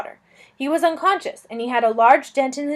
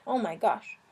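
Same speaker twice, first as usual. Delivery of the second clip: whispered; 3 semitones lower, 8 dB quieter than usual. Real, not acted. Aside reaction to the story.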